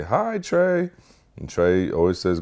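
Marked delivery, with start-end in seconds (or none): 0.0-0.9 high, softly pitched greeting; cafeteria lady. Retold or imitated. Cafeteria lady greeting the boy each morning, high imitation. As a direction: imitate a friendly lady's singsong hello. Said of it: "the cafeteria lady every morning say".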